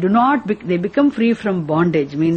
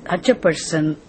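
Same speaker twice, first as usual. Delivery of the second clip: usual speaking voice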